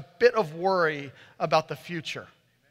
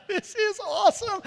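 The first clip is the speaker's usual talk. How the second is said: much higher, gleeful squeal of delight; super excited; 13 semitones higher than usual. Retold or imitated. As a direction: squeal it like a thrilled kid.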